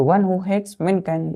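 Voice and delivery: slow, word by word; slow dictation. Shown as a demonstration. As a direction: dictate it slowly for notes. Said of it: "इसका मतलब क्या है".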